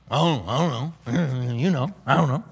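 low, soft, slow mumbling; clueless John. Acted. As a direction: mumble it like a baffled old man. Mimics John dodging the question.

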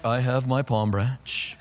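low, slow, soft monotone; mock solemn drone. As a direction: intone it with deadpan, lifeless reverence. Mocks standing stiffly with branch.

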